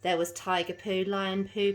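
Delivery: usual speaking voice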